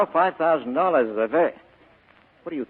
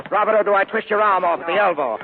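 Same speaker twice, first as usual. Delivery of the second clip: loud, high snarl of a threat; menacing threat; 8 semitones higher, 6 dB louder than usual. Acted. Spade threatens Cairo after grabbing his gun, loud and menacing. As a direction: bark it like you'll really break his arm.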